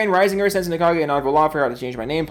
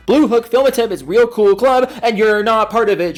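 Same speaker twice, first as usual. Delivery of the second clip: louder mock taunt; 5 semitones higher, 6 dB louder than usual. Teasing shout-out to the viewer.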